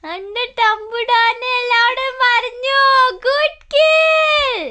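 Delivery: high, drawn-out gushing praise; delighted praise. Real, not acted. Mother praising baby who said every name, delighted.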